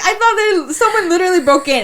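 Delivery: sister-in-law, still rattled by the scream; shaken sister-in-law. Retold or imitated. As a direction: still rattled, explain the fright. Sister-in-law explaining her fright.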